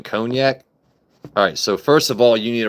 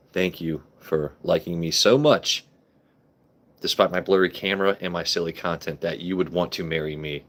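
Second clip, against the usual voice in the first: normal talking voice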